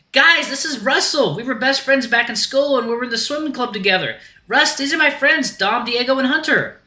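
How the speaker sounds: very high, eager introductions; excited Axel voice. Acted. Axel introduces Russell to his friends, high eager voice.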